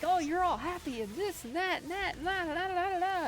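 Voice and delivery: high, loud ranting comic impression; Orny Adams rant. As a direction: yell it like a fed-up comic on stage. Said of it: "he's like".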